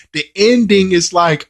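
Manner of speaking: ordinary talk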